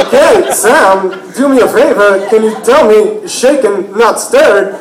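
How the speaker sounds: halting stresses, swooping pitch; slow, choppy coaxing with odd emphasis; Christopher Walken. Retold or imitated. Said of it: "I would do Christopher Walken to him".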